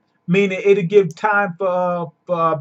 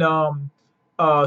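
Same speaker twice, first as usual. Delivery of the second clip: ordinary talk, nothing marked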